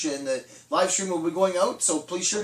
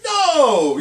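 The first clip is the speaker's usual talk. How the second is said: loud, high, drawn-out no; emphatic refusal; 7 semitones higher, 9 dB louder than usual. Acted. Man tells himself he would not do it, emphatic refusal. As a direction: refuse it loudly and firmly. Refusing temptation in imagined self-talk.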